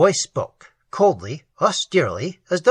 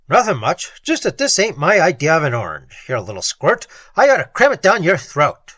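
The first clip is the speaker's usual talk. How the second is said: loud, building to a menacing threat; bully the little squirt; threatening thug; 5 dB louder than usual. Acted. Thug threatening the gardener, loud menace.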